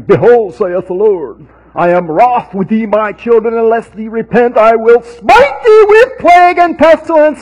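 loud, high, booming prophet proclamation; mock biblical prophet. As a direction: thunder it out like a King James prophet. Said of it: "and declaring".

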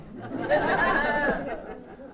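real high-pitched burst of laughter; a bright, high giggle. Real, not acted. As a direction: let a big laugh out. Laughing at caught Sarah.

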